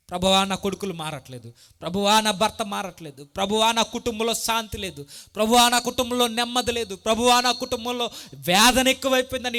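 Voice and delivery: loud, rising cry to God; pleading preacher's prayer. Acted. (గట్టిగా వేడుకునే ప్రార్థన)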